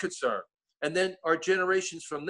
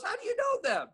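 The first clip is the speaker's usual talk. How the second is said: brother quoted. Retold or imitated. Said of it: "My brother goes".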